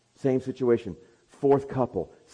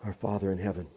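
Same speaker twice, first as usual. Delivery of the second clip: pastoral prayer; 2 semitones lower, slower than usual. Acted. Closing prayer.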